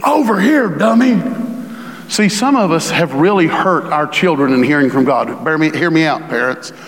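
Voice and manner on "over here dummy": higher-pitched mock holler, not truly yelled; mock scream. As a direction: mildly holler it like a rude summons. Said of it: "God's not screaming".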